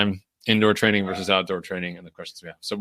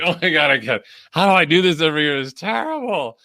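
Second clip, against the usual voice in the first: much higher, rushed out-of-breath complaint; mock gasping; 8 semitones higher than usual. Runner reenacting herself gasping behind a tree, higher and breathless. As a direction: pant it out like you can barely keep running. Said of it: "just, like, gasping for".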